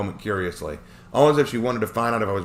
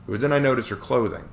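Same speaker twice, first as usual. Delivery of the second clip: ordinary delivery, nothing marked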